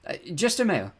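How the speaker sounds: high, hesitant call upward. A narrator's reading. Girl calls up to knife-wielding giant, calling out. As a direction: call up to someone towering over you. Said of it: "I called up to the giant".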